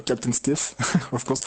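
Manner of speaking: usual speaking voice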